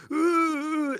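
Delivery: a choked cry of distress; high strained groan. Retold or imitated. Waking in panic, strained high groan. Retold panic attack at night.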